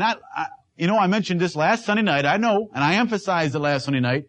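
usual speaking voice

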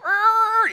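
high squeaky mock lamb bleat. Preacher imitating the pet lamb at dinner, squeaky bleat. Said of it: "and the little lamb would go".

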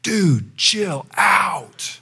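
high, drawn-out exasperated call; emphatic. Retold or imitated. Preacher re-enacting telling off a swerving driver, with great emphasis. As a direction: punch it out high, falling hard on each word. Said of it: "I said with great emphasis".

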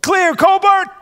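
high, loud mountain wife's call; wife calling the all-clear to her husband, high and loud. Retold or imitated. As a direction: shout the all-clear from the passenger seat. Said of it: "And she'd go".

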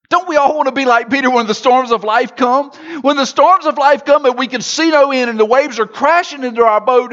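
impassioned sermon appeal; preaching build. Acted. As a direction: rouse the congregation. Preaching at full force, appeal.

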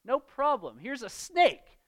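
absurdly cheery father handing over a snake; mock cheerful dad. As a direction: toss it off like a careless father. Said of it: "And the dad says".

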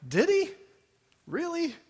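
high-pitched, sceptical questioning; disbelieving, voice lifted right up; incredulous doubter. Acted. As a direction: ask it as if you can't believe it. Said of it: "and I'm like".

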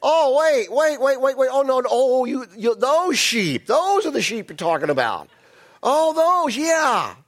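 caught-out high, loud backpedaling; flustered politician suddenly remembering; Saul. Acted. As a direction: scramble with hammed-up sudden recollection. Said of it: "what does Saul do? Like any good politician".

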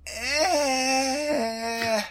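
long, high, held "ehhh"; pained whine. Acted. Reviewer reacting to a comic's yelling, pained whine. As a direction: hold a high whiny noise of discomfort.

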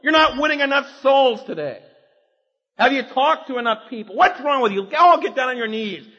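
loud nagging reproach; guilt-trip scolding. Retold or imitated. Preacher voices the guilt voice berating believers, loud scolding. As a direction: scold them like a harsh taskmaster. Voicing guilt-driven demands.

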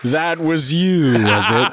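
slow, put-on accusing voice; mock interviewer. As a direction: play a suspicious boss. Imitating job interviewer.

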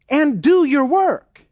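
blunt command. Acted. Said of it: "he says it very bluntly".

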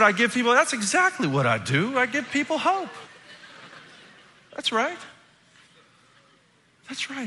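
very high, thrilled proclamation; blown away. Retold or imitated. Pastor claiming the kid's answer before class, thrilled. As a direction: seize on it in a high, delighted voice. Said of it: "I'm, like, blown away".

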